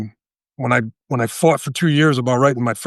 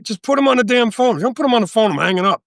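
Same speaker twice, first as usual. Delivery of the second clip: high-pitched, retold exasperation; acted irritation, pitched well up; pissed off; 10 semitones higher than usual. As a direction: demand the phone, losing patience. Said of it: "Now I'm getting pissed off".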